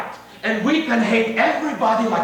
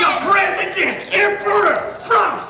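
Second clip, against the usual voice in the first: mocking rant; 9 semitones higher, slower than usual. [name] mocking all rulers to his girlfriend, shouting. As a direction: fling each title out in scorn.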